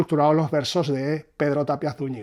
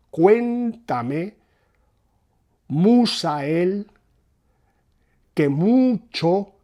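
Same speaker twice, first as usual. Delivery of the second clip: "Cuéntame, musa él, que mucho" very slow, each stressed syllable leaned on; dactylic scansion; 4 semitones higher, 4 dB louder, much slower than usual. Shown as a demonstration. Teacher slowly scanning the verse to show its dactyls. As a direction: spell out the dactyl beat by beat. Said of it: "es un esquema dactílico".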